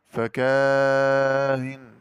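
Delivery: فكاهن with a very long held aa, then eased hamza; very slow "fakaaaa-in" for فكاهن; long madd. Shown as a demonstration. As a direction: chant the aa of فكاهن long and steady. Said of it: "مع المد والقصر".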